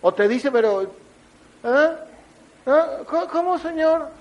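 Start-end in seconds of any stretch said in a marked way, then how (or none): 1.7-4.1 muy agudo, desconcertado; ¿ah? sin haber oído nada; confundido. Retold or imitated. Creyente distraído ante Dios. (creyente distraído, confundido y agudo)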